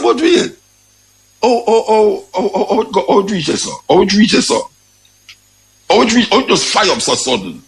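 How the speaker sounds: mock shock. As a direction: stutter in hammed-up amazement. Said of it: "Me I say".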